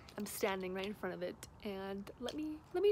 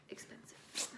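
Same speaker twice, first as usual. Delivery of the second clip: whispered; 7 dB quieter than usual. Real, not acted. Shocked at price of robe.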